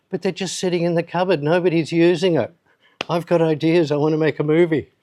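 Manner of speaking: put-on eager young plea. Pleading with teachers for cameras.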